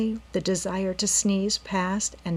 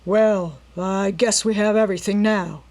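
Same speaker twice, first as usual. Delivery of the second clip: plain reading voice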